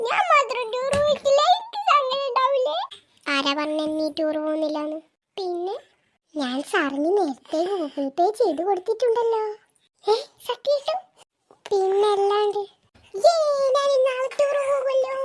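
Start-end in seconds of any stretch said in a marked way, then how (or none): 0.1-2.8 put-on high, loud tearful wail of a child left out. Girl wailing to her mother about missing the tour, high and loud. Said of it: "കരച്ചില് നിർത്തടി". 10.0-15.1 put-on high, loud delighted squeal of a child; overjoyed. Girl hearing her mother paid for the tour, high and loud with joy. Girl learns she can go.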